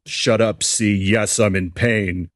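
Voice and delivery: mother screams that she is in pain; screaming. A narrator's reading. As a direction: hurl it back at him. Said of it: "she screamed some more".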